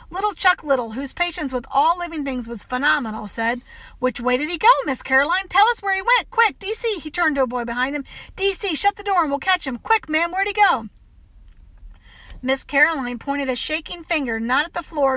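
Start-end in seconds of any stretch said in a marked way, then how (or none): 4.0-7.0 higher-pitched, eager questioning; rally everyone for the chase; urgent boy. Acted. Boy urgently hunts the supposed mouse. 8.3-10.9 higher, hurried orders to a friend; urgent boy. Acted. Boy urgently organizes the chase.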